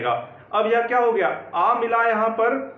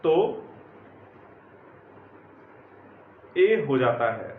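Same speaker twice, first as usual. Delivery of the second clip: slow, low, word by word with long pauses; slow dictation; 5 semitones lower, 4 dB quieter, slower than usual. Acted. Teacher dictating the rule to students, slow and measured. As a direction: spell it out for them to write down.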